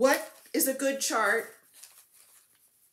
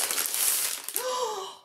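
delighted gasp; 13 semitones higher than usual. Real, not acted. Unboxing fabric.

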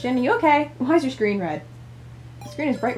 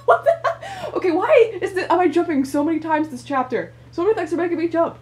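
ordinary talk, nothing marked

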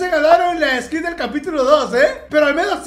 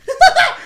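high, loud gloating cackle; mocking laugh; 10 semitones higher, 5 dB louder than usual. Real, not acted. Prankster hearing threats to report him, mocking laugh. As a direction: burst out laughing at their outrage.

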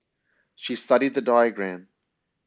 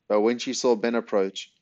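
ordinary delivery, nothing marked

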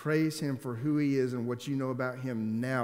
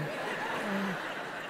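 unvoiced burst of laughter; breathy laugh; 7 semitones higher, 4 dB quieter than usual. Surprised at meeting his cousin, airy laugh. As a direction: laugh out in disbelief.

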